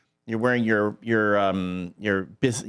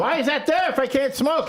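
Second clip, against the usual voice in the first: very high indignant protest; mock complaining; 12 semitones higher than usual. Host voicing smoker who can't smoke, complaining. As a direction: whine the question high, as an aggrieved smoker. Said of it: "you'd have smokers complaining".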